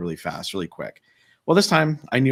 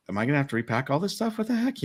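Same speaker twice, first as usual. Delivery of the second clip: quick, higher-pitched replayed complaint; retold exasperation; 7 semitones higher than usual. Recalling having to repack all this stuff, exasperated. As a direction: replay your exasperated disbelief, pitch climbing.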